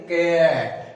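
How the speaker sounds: कैट slowly stretched out from its opening consonant; pronunciation demo. Shown as a demonstration. Teacher shows the consonant sound starting the word, कैट drawn out. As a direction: draw out कैट to show its first sound. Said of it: "इसकी जो ध्वनि है".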